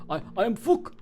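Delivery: high "I, I am Fook" with a rising end; the Klingon boss naming himself, pitched high. Acted. Streamer impersonating the Klingon boss Fook, high Klingon voice. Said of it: "and go".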